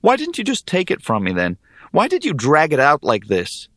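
aggrieved questioning; indignant. A narrator's reading. Hero bitterly questions hag.

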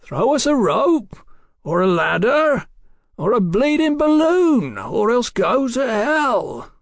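high, slow, loud demands; irritated holler, building to a curse; angry shouting. A narrator's reading. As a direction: shout each demand up the wall. Shouting angrily up at the man.

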